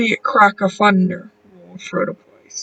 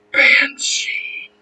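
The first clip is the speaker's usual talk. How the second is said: whispered; 5 semitones higher than usual. A narrator's reading. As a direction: breathe the word. Written word, whispered.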